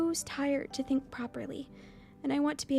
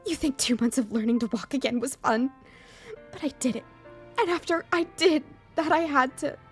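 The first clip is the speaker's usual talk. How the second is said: heated, louder defensive retort; angry defiance; 3 semitones higher, 5 dB louder than usual. Acted. Emmy defending what she went through, angry. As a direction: throw the hardship back at them, angrily. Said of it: "quickly falls back to anger".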